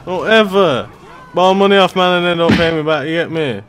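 loud demanding yell for the fiver; angry shout. Acted. Gamer yelling at the debtor for money, angry shout. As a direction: bark at him to pay up.

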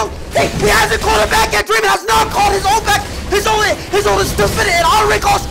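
high, loud esports shoutcasting; frantic play-by-play. Real, not acted. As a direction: shout the team fight at full pitch as it happens. Live play-by-play of team fight.

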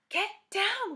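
usual speaking voice

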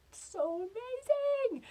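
high, drawn-out hushed squeal; slow, high-pitched amazement; awed squeal. Acted. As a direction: squeal with wonder, keeping it stifled. Marvelling without waking the gorilla.

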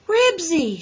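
high, loud cry falling away; anxious shout to a dog. A narrator's reading. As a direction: shout the dog's name with sinking hope. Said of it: "shouted Henry, with a sinking feeling".

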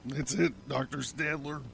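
soft, resigned answer; weary. A narrator's reading. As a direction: give in tiredly, with little force. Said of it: "he said wearily".

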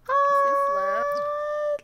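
a high, delighted squealed oh; gushing squeal. Real, not acted. Vlogger gushing at her grown-up Sim sister, high squeal. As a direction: melt at how grown up she is.

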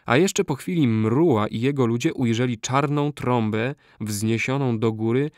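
usual speaking voice

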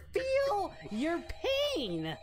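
drawn-out, high mock pity; mock sympathy. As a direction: ham up the fake sympathy.

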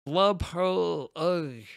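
slow, mangled, tongue-tied nonsense; dumbstruck babble. A narrator's reading. Frederick stammering at the princess, slow dumbstruck babble. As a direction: babble like a lovestruck fool.

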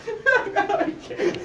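high, helpless giggling; laughing too hard. Real, not acted. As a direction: give up on the line through laughter. Corpsing in the middle of the take.

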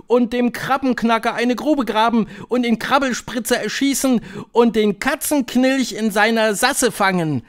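high, loud voice of a rowdy coven; high-pitched, boisterous crowd; bawling. A narrator's reading. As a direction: boast noisily like an excited crowd. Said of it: "grölten sie durcheinander".

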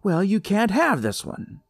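high, loud nasal refusal; shrill bully girl. A narrator's reading. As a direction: bark it like a spoiled bully.

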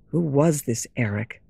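ordinary delivery, nothing marked

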